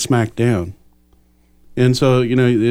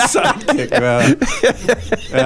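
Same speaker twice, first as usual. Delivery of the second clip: laughing; 13 semitones higher, much slower than usual. Real, not acted. Laughing at the sidekick joke.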